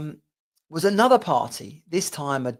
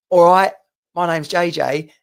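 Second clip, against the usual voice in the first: loud, higher hearty greeting; loud, upbeat self-introduction; cheery intro; 4 semitones higher, 9 dB louder than usual. Retold or imitated. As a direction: introduce yourself boldly to strangers. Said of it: "you're saying to these people".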